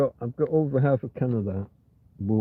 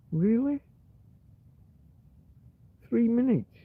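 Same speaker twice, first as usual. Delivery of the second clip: high, disbelieving question; surprised; 8 semitones higher, slower than usual. Real, not acted. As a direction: sound genuinely taken aback. Surprised at lost time.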